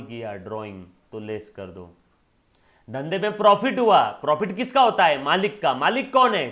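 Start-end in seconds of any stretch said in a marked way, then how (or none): 2.9-6.5 high, shouted question and answer; emphatic, swooping classroom shout; loud quizzing. Real, not acted. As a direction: fire the questions out at full voice. Teacher quizzing class at full voice.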